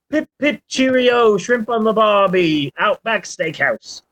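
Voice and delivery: a light, hammed-up fake Australian accent that starts high; bad Australian accent. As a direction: play a friend doing a clumsy Aussie impression. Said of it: "that's what she's always saying".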